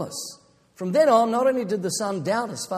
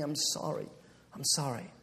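softer, lower remorseful plea; quiet sorry; 5 semitones lower, 10 dB quieter than usual. A narrator's reading. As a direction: murmur it, close to tears. Boy weeping in father's arms.

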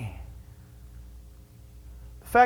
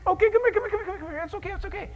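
soothing, fading; 9 semitones higher, much faster than usual. Acted. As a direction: hush them down gently. God comforting, fading.

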